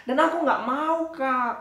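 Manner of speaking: put-on high, loud childish no; a high whiny refusal to the teacher; stubborn refusal. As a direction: dig in like a stubborn kid.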